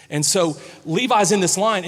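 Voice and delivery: ordinary talk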